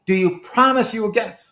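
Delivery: mock anxious passenger pressing; insistent demand.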